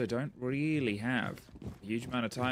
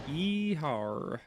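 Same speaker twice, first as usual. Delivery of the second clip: cowboy yeehaw; 3 semitones higher than usual. Pretend. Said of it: "do a good cowboy voice?".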